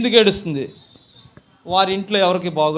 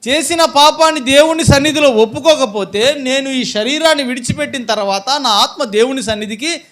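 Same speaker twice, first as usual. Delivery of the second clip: loud, higher, urgent sermon voice; forceful preaching; 6 semitones higher, 8 dB louder than usual. Real, not acted. Preacher voicing the sinful woman's resolve to confess, forceful preaching. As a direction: preach it out with raised voice.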